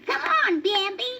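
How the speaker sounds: high little rabbit asking; baby rabbit voice. A narrator's reading. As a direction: voice a small eager bunny. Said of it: "said Thumper".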